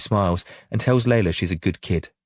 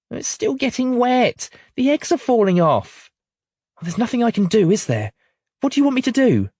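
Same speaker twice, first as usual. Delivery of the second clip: put-on upset child and harassed mum. A narrator's reading. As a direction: let the girl fret and the mum snap back. Child and mum arguing in rain.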